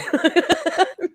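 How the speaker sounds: a high, real, teary laugh; half-wailing laugh of being moved; tearful laugh. Real, not acted. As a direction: laugh as if close to crying. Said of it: "on the verge of wailing".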